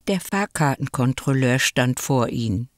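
ordinary delivery, nothing marked

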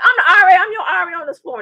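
high, loud pulling rank; authoritative declaration. Retold or imitated. RA pulling rank on the noisy student, loud and firm. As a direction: announce your authority sharply.

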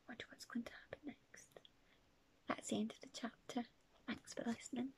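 soft unvoiced whisper to end; whispered sign-off. Real, not acted. Narrator closing the chapter for listeners, hushed whisper. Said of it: "whispered sign-off".

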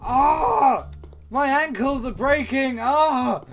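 pained yelling. Pretend. Character falling, ankles breaking.

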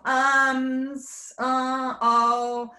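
very slow, stretched "lots of ums"; drawn-out filler. Shown as a demonstration. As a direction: drag it out like a hesitating speaker. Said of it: "filler words".

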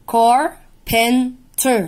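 carpenter split slowly into its syllables car-pen-ter. Shown as a demonstration. Teacher breaking a word into syllables for students, slow and clear. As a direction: sound out carpenter one syllable at a time. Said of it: "فبص كلمة farm اتقسمت far ومر".

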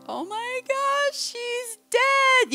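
high, slow, terrified wail; panicked. Retold or imitated. Mother losing her toddler, panicked wail. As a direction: stretch it out in sheer panic.

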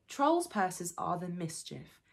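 ordinary delivery

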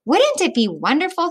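dreamy wish. Acted. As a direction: dream out loud about a better world. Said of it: "he thought".

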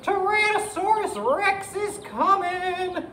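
very high, slow, loud wail; alarmed cry. Acted. Triceratops warns Ankylosaurus of the T. rex, high cry. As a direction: stretch the warning out in high alarm. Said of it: "she cried to Ankylosaurus".

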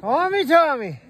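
loud drawn-out call across the ice. Real, not acted. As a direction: call his name out loud. Calling out at ice hole.

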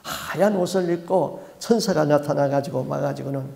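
usual speaking voice

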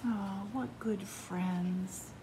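slow tender whisper. Real, not acted. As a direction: breathe it softly, fondly. Soft aside after the story.

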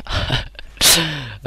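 helpless laugh mid-story; amused laugh. Real, not acted. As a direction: break off into a real laugh. Laughing at his own drunk story.

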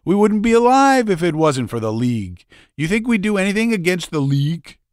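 high, loud, pressed insisting voice; indignant fat man. Acted. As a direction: insist he'd never betray them. Tub man insisting loyalty.

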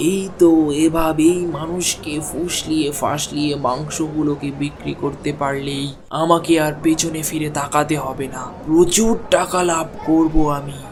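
scheming aside. Acted. Butcher gloats alone about fooling customers, sly and low. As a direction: gloat under your breath like a cheat.

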